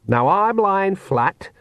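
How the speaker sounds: calmly chatty in a crisis; conversational Nanny Ogg. A narrator's reading. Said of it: "said Nanny Ogg conversationally".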